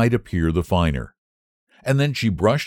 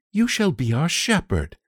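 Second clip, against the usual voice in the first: put-on high, light young lady's voice; pleasantly; 10 semitones higher than usual. Young lady welcoming the boy, pleasant. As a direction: offer it kindly and gladly. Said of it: "she said pleasantly".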